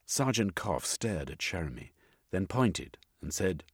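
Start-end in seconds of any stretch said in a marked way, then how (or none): none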